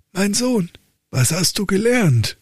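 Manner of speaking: high, exasperated father voice; old count lecturing his dull son; stern old count. Acted. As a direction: play the despairing old father.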